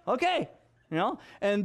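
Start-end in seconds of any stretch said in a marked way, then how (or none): 0.1-0.5 startled okay. Real, not acted. Father hears daughter wants eleven kids, surprised high okay. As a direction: react with startled disbelief.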